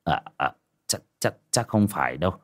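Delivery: put-on low, hesitant old man stammering; flustered old father. A narrator's reading. Old father denying the bad news, low stammer. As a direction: falter softly, repeating each word.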